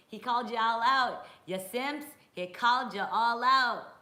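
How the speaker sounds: loud, high mock-scolding call-out; teasing shout. Real, not acted. Streamer calling out the viewers as simps, loud teasing. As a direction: tease the whole chat loudly, laughing at them. Teasing chat after a comment.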